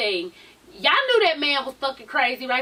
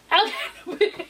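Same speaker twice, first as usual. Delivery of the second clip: laughing taunt; 7 semitones higher, faster than usual. Retold or imitated.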